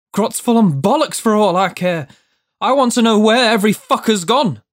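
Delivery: high, loud, indignant outburst; angry demand. A narrator's reading. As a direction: demand answers, loud and heated. Frightened man demands answers angrily.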